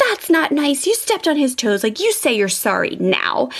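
lightly acted telling-off, firm at the end; scolding mom. Mom to child who stepped on brother's toes, mock scolding.

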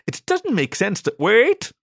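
a high squeak, cutting himself off. A narrator's reading. Ziggy suddenly realises what happened, high squeak. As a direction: jump up high as it dawns on him. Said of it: "Ziggy squeaked".

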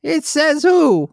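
slow, high goofy voice mangling the word; silly high voice. Pretend. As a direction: play the dimwit mishearing, drawn out. Dan's falsetto bit character.

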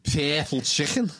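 slow Scouse "purple chicken", purple as "pair-pull"; Scouse accent. Shown as a demonstration. Showing how to know a Scouser, Scouse accent. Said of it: "how do you know someone's a Scouser".

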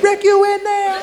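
mock echo, high and slow with a laugh; echoing friend. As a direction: copy the question back teasingly. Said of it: "He said".

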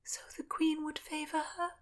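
a wretched answer barely voiced; small, miserable question. A narrator's reading. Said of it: "answered miserably".

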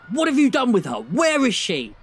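high, loud desperate demand; frantic father. Acted. Father grabbing the boy, demanding his daughter, loud and frantic. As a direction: shake the answer out of him, shouting high.

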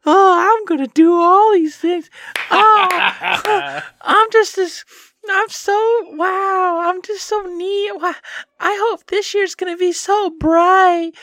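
very high, loud gushing mockery; mocking past self. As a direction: squeal naive excitement in a hammed-up voice. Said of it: "Just going back and listening".

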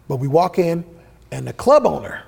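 ordinary talk, nothing marked

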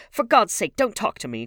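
high, loud outburst; angry cry. A narrator's reading. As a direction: cry out in exasperation. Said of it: "cried Dorian".

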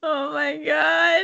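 high, slow laughing groan. Real, not acted. Laughing at the silly name.

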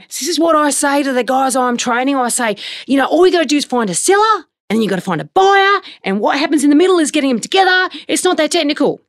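high, exaggerated know-it-all trainer impression; put-on locker-room bloke accent. Host imitates trainer coaching the young guys, hammed-up bloke accent. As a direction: ham up a matey trainer preaching his simple formula. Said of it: "this is my accent".

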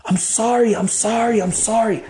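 mild re-enacted cry for it to stop; retold scream. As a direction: beg with pitch way up, volume held. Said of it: "I screamed".

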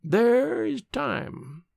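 high, very slow intoning; old crone's drawn-out insistence; ancient woman. A narrator's reading. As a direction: stretch each word out high and slow. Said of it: "said the ancient woman".

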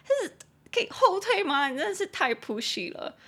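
high indignant complaint, easing down; indignant scolding. Acted. Scolding a friend's pushy boss, exasperated and high. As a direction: tell her off in exasperation.

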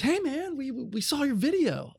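imitating mentor. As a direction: play the mentor breezily bringing it up. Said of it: "Bobby looks at me and he goes".

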